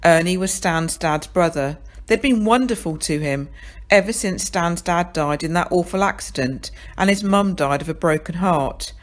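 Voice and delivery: ordinary delivery, nothing marked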